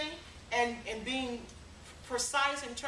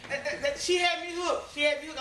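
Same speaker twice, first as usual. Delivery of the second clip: laughing; 6 semitones higher, 3 dB louder than usual. Real, not acted. Laughing at own story.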